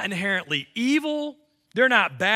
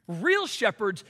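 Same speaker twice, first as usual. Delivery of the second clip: ordinary talk, nothing marked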